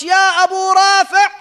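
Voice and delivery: loud, high shout across the treasury; storming in and shouting for the treasurer, loud and high; yelled call. Retold or imitated. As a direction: bellow the name to summon him. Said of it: "he yelled out".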